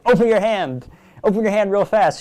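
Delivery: loud, quick stage command; urgent command. Retold or imitated. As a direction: bark it out urgently. Retelling his command on stage.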